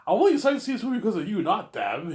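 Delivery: fast, high sales flattery; mock eager flattery; fast flattering pitch. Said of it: "I'm saying".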